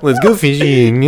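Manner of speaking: put-on slow frat-boy version of a tech boss; mocking, laughing impression; Mark Zuckerberg. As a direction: drag it out in a put-on voice. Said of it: "if Mark Zuckerberg was in a frat".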